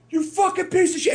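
very high-pitched mock outburst; imitated furious player. Dorm guy confronting the sniper before a fistfight, mock fury.

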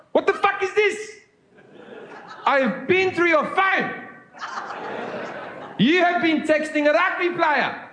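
high, outraged South African shout; South African accent. Acted. Boyfriend confronts girlfriend over phone texts, angry South African accent. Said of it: "I will now be doing a South African accent".

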